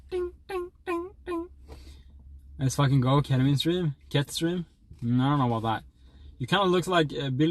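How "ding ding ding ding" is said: tiny, high, soft "ding" chimes; high dinging noise. Acted. Streamer imitating miners at work, high dings. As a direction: imitate clinking in a quiet high squeak.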